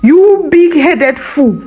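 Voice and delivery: slow, high angry yell. A narrator's reading. Wife insulting her husband, slow high yell. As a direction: hurl the insult at him. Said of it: "she yelled".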